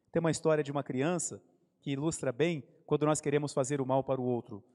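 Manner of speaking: normal talking voice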